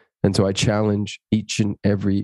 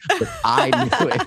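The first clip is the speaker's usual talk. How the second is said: laughing; 4 semitones higher than usual. Real, not acted. Knowing boast about his wife.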